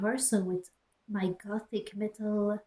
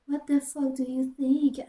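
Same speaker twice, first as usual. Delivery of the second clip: snapping, a bit higher and louder; angry; 5 semitones higher, 4 dB louder than usual. Acted. [name] snaps back at Bloody Mary, angry. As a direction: throw it back angrily. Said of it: "I asked angrily".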